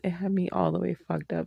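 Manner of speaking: usual speaking voice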